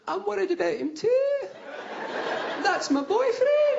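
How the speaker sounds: very high, put-on wail; hammed-up distressed girlfriend; distraught woman. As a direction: wail it, drawing out the last words. Woman wailing about collapsed boyfriend.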